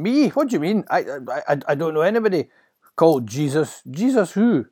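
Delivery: high, loud stammering denial; flustered Peter. Acted. Peter denying his master by the fire, flustered. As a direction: bluster your way out of trouble.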